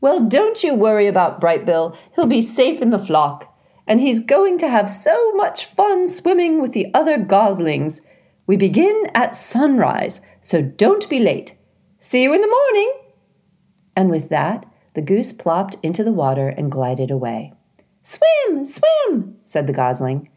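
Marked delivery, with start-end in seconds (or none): 0.0-12.9 put-on louder, cheery old goose greeting; old goose Loudwing. A narrator's reading. Loudwing waddling into the garden, hearty goose voice. 18.0-19.4 put-on much higher, eager chirping child; excited little gosling. A narrator's reading. Brightbill recognizing Loudwing, high gosling voice.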